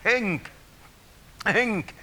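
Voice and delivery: sudden high cry of the word Henke; ecstatic high cry. Real, not acted. (korkea hurmoksellinen huudahdus)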